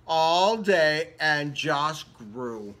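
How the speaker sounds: ordinary delivery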